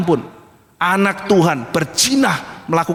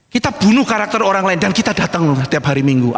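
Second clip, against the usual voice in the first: ordinary delivery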